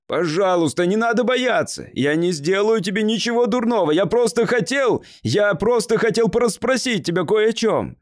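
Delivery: loud, high, imploring reassurance; desperate shouting. A narrator's reading. Man shouting to a frightened boy not to fear him, imploring. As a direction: call out urgently, begging to be trusted. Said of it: "снова закричал Слоун".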